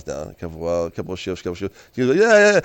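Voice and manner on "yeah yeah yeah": high, loud string of yeahs; acted excited rapid-fire cheer; eager hype. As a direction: pump yourself up as the player would. Replaying the player's eager response.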